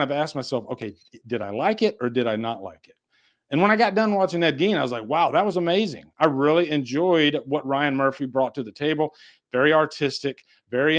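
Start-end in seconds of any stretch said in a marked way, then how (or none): none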